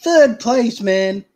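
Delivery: real delight bursting out; excited. Real, not acted. Reads the award, thrilled.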